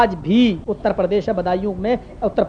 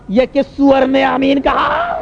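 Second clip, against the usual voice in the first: higher, slow, stretched-out bellow; imitating imam's angry shout; 4 semitones higher, slower than usual. Imam hunting who said Ameen, angry drawn-out shout. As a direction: demand it in a drawn-out yell. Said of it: "یہ style تھی اس کی".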